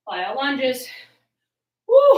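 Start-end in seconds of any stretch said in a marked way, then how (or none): 1.9-2.2 loud high whoop; excited whoop. Real, not acted. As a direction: let out a big whoop. Mid-workout cheer of effort.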